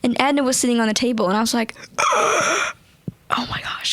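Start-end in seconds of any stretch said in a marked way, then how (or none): 2.0-3.9 awed gasping whisper; breathless gasp. Retold or imitated. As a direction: gasp it out in wonder. Spotting the puppet on table.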